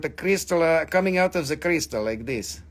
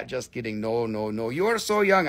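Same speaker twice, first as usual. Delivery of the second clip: normal talking voice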